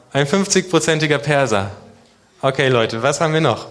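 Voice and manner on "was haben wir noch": ordinary delivery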